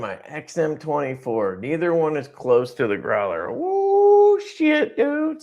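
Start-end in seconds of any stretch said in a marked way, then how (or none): 3.5-5.4 sudden high whoop of excitement; excited whoop. Real, not acted. Hyped about the Growler beating his knives, high whoop. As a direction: yelp it out, thrilled. Excited over the knife comparison.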